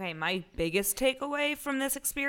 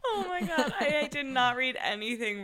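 laughing; 6 semitones higher than usual. Real, not acted. Laughing over the Serena spirit joke.

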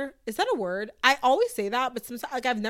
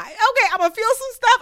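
much higher and louder, giddy and playful; giddy declaration; 13 semitones higher, 10 dB louder than usual. Acted. Podcast host to listeners, gleefully insisting she'll feel everything. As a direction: squeal it like you can't contain your feelings.

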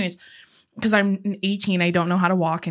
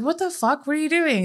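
higher retold protest; mock indignant question, pitched up; startled driver; 7 semitones higher than usual. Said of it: "he's like".

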